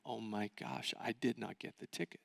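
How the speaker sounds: deflated whisper of defeat; crushed realization. Retold or imitated. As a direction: let the news land quietly. Realizing he failed.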